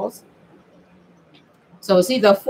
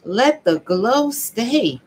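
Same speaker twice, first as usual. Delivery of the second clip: slow drawn-out pleading; slower than usual. Retold or imitated. Answering friends about her glow, slow and pleading. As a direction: stretch it out, begging it to stay. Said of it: "I was like".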